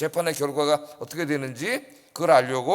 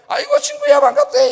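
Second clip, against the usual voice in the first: high, loud burst of Gyeongsang dialect greeting; 10 dB louder than usual. Retold or imitated. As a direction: greet an old hometown friend in broad Gyeongsang dialect. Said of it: "사투리가 막 나오는 거예요". (높고 큰 소리의 경상도 사투리 인사)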